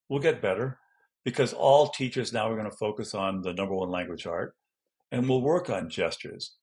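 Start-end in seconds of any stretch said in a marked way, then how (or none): none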